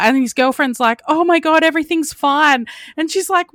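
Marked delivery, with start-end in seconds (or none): 1.1-2.7 higher-pitched imitated relief; relieved exclamation. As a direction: voice lifts in retold surprise. Girlfriend's relief, retold.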